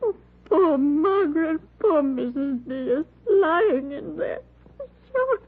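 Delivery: high, sobbing voice cracking on shock; breaking into sobs. Acted. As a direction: weep through the words as grief overwhelms her. Neighbour breaks down over victims.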